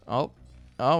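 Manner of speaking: usual speaking voice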